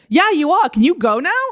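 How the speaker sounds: high, loud, pointed comeback; emphatic fed-up reply; exasperated retort, retold. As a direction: act out standing up to a troublemaker. Teller's retort to the aggressor.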